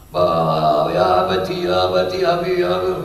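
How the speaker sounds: sing-song wail for a father, acted softly; mock tearful wailing. Child crying to his long-absent father, imitated tearful wail. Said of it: "nangis, istrinya nangis".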